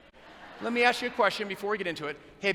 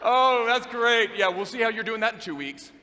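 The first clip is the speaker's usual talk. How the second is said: sarcastic; 4 semitones higher, 7 dB louder, slower than usual. Retold or imitated. Sarcastic guy dismissing her.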